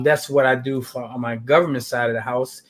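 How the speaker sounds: ordinary delivery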